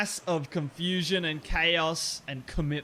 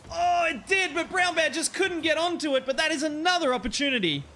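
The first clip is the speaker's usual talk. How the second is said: high-pitched rush of commentary; urgent live sportscaster, pitched high; excited near-miss call; 8 semitones higher than usual. Real, not acted. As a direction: cry out at the missed chance.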